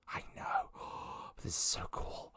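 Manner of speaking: excited whisper with a gasp; awed whisper. Acted. Man confides how cool she is, awed whisper. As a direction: gush in a tiny whisper. Awestruck character whispers to party.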